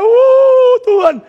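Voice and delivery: sudden scared shout; frightened cry. Retold or imitated. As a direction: cry out to God in terror. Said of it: "orang Israel ketakut teriak".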